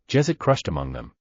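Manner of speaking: usual speaking voice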